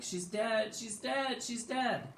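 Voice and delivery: very high mock wail; high-pitched, overacted lament; freaked-out wailing. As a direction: repeat it in hammed-up hysterics. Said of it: "does the same type of thing".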